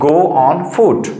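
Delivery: drawn out very slowly, each word apart; slow model sentence. Shown as a demonstration. Teacher modelling a phrase for parents, very slow. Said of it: "कैसे बोलोगे?".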